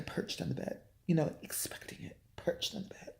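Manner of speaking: whispered aside in an acted account; hushed retelling. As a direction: drop to a whisper for the acted memory. Acts the retelling in a hush.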